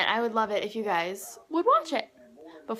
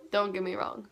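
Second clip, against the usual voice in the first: usual speaking voice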